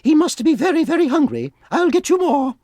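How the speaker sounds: high motherly bear, gently puzzled; Mama Bear answering Papa Bear about the soup. Acted. Mama Bear comforting Baby Bear.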